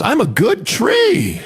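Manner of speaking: high, drawn-out boastful shout; hammed-up high-pitched yell; mock scream. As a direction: scream it slowly like a self-promoting braggart. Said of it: "how loud it screams".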